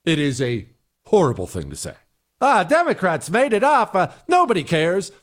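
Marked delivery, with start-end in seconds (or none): 2.4-4.0 higher, careless brush-off; sneering parody of a brush-off; dismissive mock quote. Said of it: "is a ridiculous answer". 4.0-5.1 higher, shrugging brush-off; mock the shrug; dismissive mock quote. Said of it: "is a ridiculous answer".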